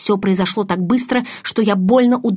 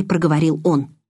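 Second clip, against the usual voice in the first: ordinary delivery, nothing marked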